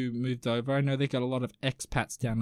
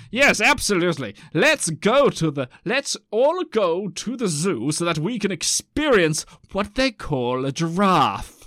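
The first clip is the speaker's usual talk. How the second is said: hammed-up old-timey zoo visitor, loud and higher; old-timey Victorian; 3 semitones higher, 6 dB louder than usual. Podcaster playing Victorian Londoners off to the zoo, hammed-up old-timey voice. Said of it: "the old-timey people going like".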